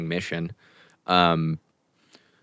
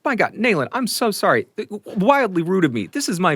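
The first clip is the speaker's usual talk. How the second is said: much higher, louder gushing apology; Chance apologizing; 13 semitones higher, 5 dB louder than usual. Acted. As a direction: fall over yourself apologizing.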